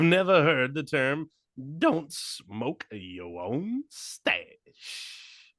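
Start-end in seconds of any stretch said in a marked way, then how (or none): 1.8-5.5 rapper voice. Retold or imitated. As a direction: drag it out slow and soft like a rapper. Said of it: "this is how I think [name] talks".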